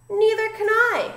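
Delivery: high, loud wail; howled. A narrator's reading. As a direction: howl it out in mock panic. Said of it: "howled Flitter".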